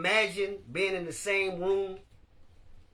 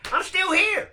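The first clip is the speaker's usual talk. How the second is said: high, loud proud declaration; loud movie quote; 11 semitones higher, 6 dB louder than usual. Retold or imitated. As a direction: belt it like a film hero. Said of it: "I love that movie".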